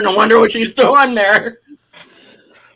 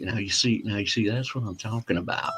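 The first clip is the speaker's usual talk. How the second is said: much lower and softer, laid-back; McConaughey impression; 9 semitones lower, 13 dB quieter than usual. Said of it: "it's something like that".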